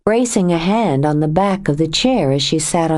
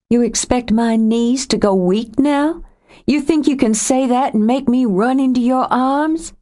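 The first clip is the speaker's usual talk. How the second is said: a higher woman's voice, rising with hurt; Joe, wounded and angry; 7 semitones higher than usual. A narrator's reading. Joe accusing Nathan of leaving, wounded and angry. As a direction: accuse him of leaving her.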